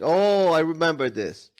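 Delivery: ordinary delivery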